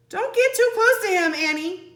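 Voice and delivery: high, loud warning; shout. Acted. Jack warning Annie near the creature, loud and high. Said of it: "Jack shouted".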